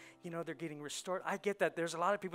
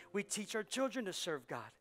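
normal talking voice

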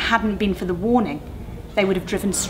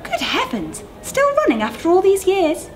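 higher and louder, amazed; astonished; 13 semitones higher, 4 dB louder than usual. Acted. Maggie exclaims to Bert in amazement, higher. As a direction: marvel at it.

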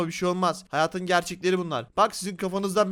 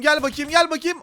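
higher-pitched, quick repeated summons; playful raised-pitch beckoning; mock beckoning call; 7 semitones higher, 5 dB louder than usual. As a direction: call your prey over playfully. Coaxing villager over to attack.

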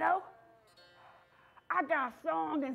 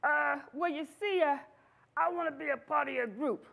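plain reading voice

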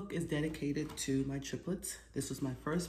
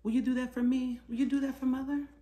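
higher, quick teasing plea; playful plea; 10 semitones higher, 5 dB louder, faster than usual. Acted. Mother coaxes her giggling son, playful plea. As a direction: coax him playfully.

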